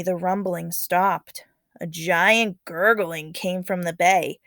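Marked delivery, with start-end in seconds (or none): none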